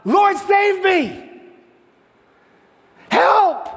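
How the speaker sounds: high, loud, slow cry of panic; desperate cry for help. Acted. Peter sinking in the storm cries to Jesus, a loud desperate plea. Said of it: "he cried out".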